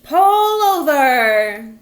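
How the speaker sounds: very loud, slow and drawn-out through a bullhorn; slow megaphone bellow; bullhorn shout. A narrator's reading. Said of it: "He shouted into the bullhorn".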